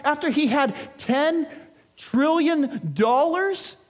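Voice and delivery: preacher voicing the onlookers' outrage; indignant disbelief. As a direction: sound scandalized at the injustice.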